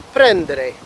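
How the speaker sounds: "prendere" with the emphasis on PREN; stress on first syllable. Shown as a demonstration. Teacher listing ERE verbs, stressing PREN in "prendere". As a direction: hit PREN at the start of "prendere". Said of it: "falling on the beginning".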